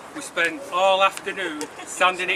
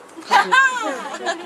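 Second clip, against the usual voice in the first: laughing; 5 dB louder than usual. Real, not acted. Laughs at the mic.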